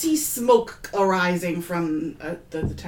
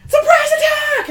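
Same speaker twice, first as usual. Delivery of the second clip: high, very loud ambush shout; yelled battle cry; 12 dB louder than usual. Acted. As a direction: burst in yelling to startle them. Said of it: "I'm gonna yell out".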